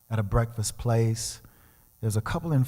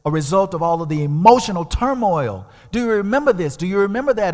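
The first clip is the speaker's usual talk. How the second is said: pleading confrontation; 7 semitones higher, 5 dB louder than usual. Retold or imitated. Confronting father on phone.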